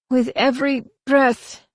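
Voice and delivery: high, strained defiance; a doomed heretic gasps his creed, high and defiant; gasping prisoner. A narrator's reading. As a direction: spit it out between breaths. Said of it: "the prisoner gasped".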